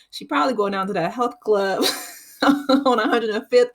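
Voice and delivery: quoting Smokey while cracking up; laughing through quote. Retold or imitated. Retelling Smokey's jab to Craig, laughing. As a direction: giggles breaking into the punchline. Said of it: "He's like".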